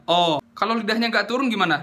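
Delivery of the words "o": "A" rounded into "O"; mouth not opened. Shown as a demonstration. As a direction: say "O" with the mouth cavity narrowed. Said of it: "Kalau nggak dibuka rongga mulutnya gimana".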